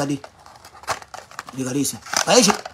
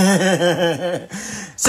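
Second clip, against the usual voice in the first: amused run of chuckles; chuckling laugh; 3 semitones lower than usual. Real, not acted. Streamer laughs about his own collarbones, chuckling.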